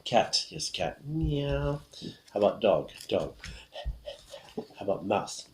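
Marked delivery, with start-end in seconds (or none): 1.0-1.8 high, drawn-out cat meow; imitated meow. Shown as a demonstration. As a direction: mew like a cat for the learners. Animal sound for the cat card.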